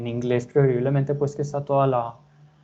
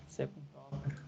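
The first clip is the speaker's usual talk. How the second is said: much softer, half to himself while typing; muttered under breath; 19 dB quieter than usual. Real, not acted. Teacher types code, mutters it to himself quietly. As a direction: mumble the code as you type it.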